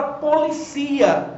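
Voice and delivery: policia stressed on CI, po-li-CI-a. Shown as a demonstration. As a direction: move the weight onto a long CI in policia. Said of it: "sem o acento".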